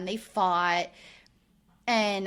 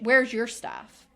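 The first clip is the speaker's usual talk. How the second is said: usual speaking voice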